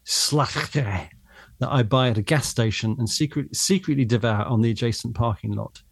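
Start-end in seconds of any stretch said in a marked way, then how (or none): 0.0-1.1 "Slatch-" with a rasped throat sound at the end; guttural Dutch. Shown as a demonstration. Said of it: "that'll be a guttural sound".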